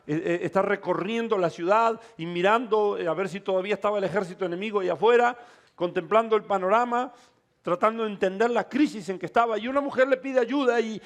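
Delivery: usual speaking voice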